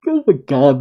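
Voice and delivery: ordinary delivery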